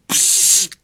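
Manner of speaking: sharp "psst" of escaping air; voiceless hiss of breath; hissed exhale. A narrator's reading. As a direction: let out a long hiss. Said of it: "udělal maličký".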